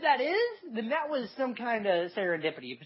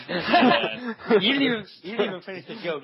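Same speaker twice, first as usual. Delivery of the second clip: laughing; 3 semitones higher, 8 dB louder than usual. Real, not acted. Laughing disbelief.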